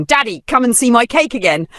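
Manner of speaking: high, loud happy call to daddy; excited child shout. Acted. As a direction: call out like an eager little girl. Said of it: "giggling, shouting".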